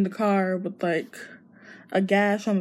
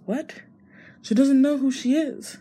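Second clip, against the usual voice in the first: higher, startled disbelief; shocked disbelief; 6 semitones higher than usual. Retold or imitated. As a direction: react in sudden shock. Miguel hears about the amnesia.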